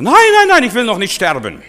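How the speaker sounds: shrieking high and loud nein nein nein, then easing; panicked scream. Retold or imitated. Preacher retelling a woman on the street screaming in panic. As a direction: scream it in terror of dying. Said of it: "Dann schrie die Frau".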